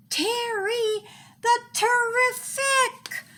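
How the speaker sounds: high, slow, drawn-out naming; grand reveal of a new nickname; proud announcement. A narrator's reading. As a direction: unveil the new name like a prize.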